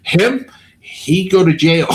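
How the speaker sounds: hint of the lady's broken English. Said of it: "She goes".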